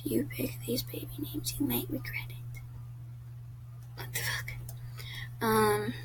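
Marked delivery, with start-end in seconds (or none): 0.0-4.5 soft unvoiced read of the title; hushed reading to herself; whispered reading. Real, not acted. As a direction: whisper the quiz name like a secret. Whispers quiz title and reaction.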